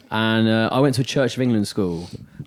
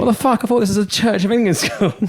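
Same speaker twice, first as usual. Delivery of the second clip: incredulous; 8 semitones higher than usual. Retold or imitated. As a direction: react with stunned disbelief. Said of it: "I'm just like".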